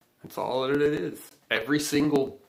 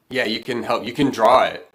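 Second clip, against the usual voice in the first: louder, eager call across the room; 10 dB louder than usual. Real, not acted. Host calls out to Angel to draw pictures, loud and eager. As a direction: call out to her with enthusiasm.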